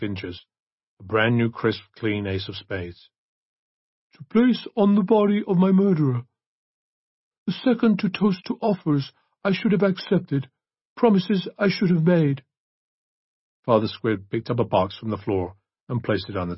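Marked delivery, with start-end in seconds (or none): 4.1-12.4 high, measured recital; priest reading a will. A narrator's reading. Priest reads Archer's bequest from the murdered woman, formal recital. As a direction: read it out in a raised priest's voice.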